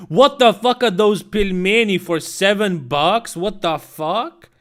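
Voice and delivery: loud, disbelieving yell at the price; reacting to pricey pelmeni, a loud incredulous outburst; outraged shout. Real, not acted. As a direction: blurt it out in real outrage.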